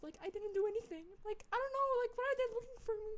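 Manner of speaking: high, rushed panicked squeal; retold panic, pitch leaping up fast; creeped out. Telling how they feared cops at the door, creeped-out high whine. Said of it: "was creeped out".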